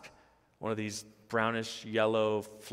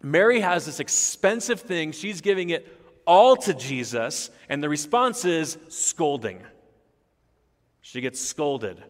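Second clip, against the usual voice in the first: raised, louder pulpit delivery; impassioned preaching; 5 semitones higher, 7 dB louder than usual. Real, not acted. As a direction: preach it with rising force, driving home each point.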